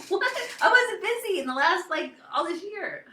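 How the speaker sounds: high, loud re-enacted disbelief; amazed. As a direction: act out astonishment at sudden success. Retold amazed reaction to sales.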